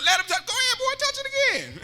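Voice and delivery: laughing grandma. Retold or imitated. Grandma lets the boy touch the stove, laughing. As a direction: let it rise into a laugh as you tease. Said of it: "That's what she told me".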